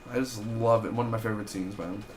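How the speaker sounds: ordinary delivery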